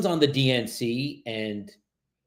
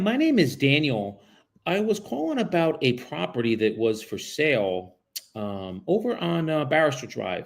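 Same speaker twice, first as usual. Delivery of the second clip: raised, polite cold-call voice; phone sales voice; 5 semitones higher than usual. Acted. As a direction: sound friendly and professional on the phone.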